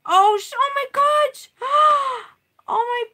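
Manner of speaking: very high, loud cry of shock; horrified shriek. Real, not acted. Seeing her painting ruined, loud horrified shriek. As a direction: shriek in real horror at the ruined work. Discovers more damage.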